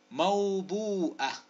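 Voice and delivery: very slow "موبوءة"; syllable-by-syllable. Shown as a demonstration. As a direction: draw out "موبو" and end on a short "ءة". Sounding out word for spelling.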